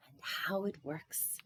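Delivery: slow whisper, quietly delighted; pleased whisper. Acted. Rabbi to himself about the sign, whispered. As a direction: hush it like a private joke. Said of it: "he thought".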